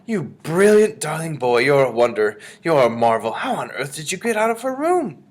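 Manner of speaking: loud, high, gushing praise; thrilled, doting grandmother; delighted amazement. A narrator's reading. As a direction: marvel aloud, voice soaring.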